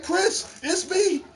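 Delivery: pitched high, pleading with a friend; high-voiced mock plea; high pleading call. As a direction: squeak out a plea to your buddy. Pleading with teammate in game.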